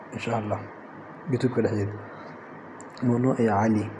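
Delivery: ordinary talk, nothing marked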